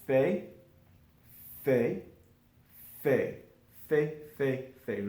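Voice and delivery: "fait" said as fay, no final t sounded; pronounced fait. Shown as a demonstration. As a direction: repeat "fait" as a clean fay with a silent t. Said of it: "this is all pronounced fait".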